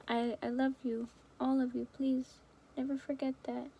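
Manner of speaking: ordinary talk, nothing marked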